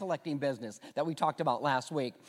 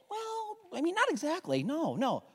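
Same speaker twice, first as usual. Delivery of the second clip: much higher, evasive squeak; sheepish dodge; 10 semitones higher, 5 dB quieter than usual. Acted. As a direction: squirm out of the question. Disciple dodges friends' question.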